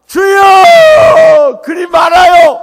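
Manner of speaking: very high, long drawn-out cry of protest; pleading shout. Acted. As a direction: bellow it out, stretching the vowels. Acting Peter's protest to Jesus. (길게 늘여 높게 절규하듯)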